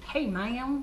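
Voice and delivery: mock Southern greeting; Southern accent. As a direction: drawl ma'am like a Southerner. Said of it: "you'll start saying".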